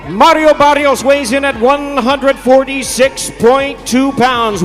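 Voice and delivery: usual speaking voice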